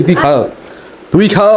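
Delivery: ordinary delivery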